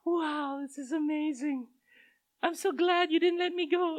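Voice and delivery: high, hammed-up little-girl gushing; mock grateful daughter. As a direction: overplay a child's tearful amazement. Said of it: "and she was like".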